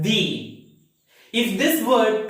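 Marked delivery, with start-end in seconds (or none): 0.0-0.6 "the" said as thee, with long ee; long ee vowel in "the"; strong form of the. Shown as a demonstration. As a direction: draw out "the" to rhyme with see. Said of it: "have to read this word as".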